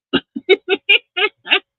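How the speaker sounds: a short, high real laugh; a quick high laugh. Real, not acted. Laughing at own remark.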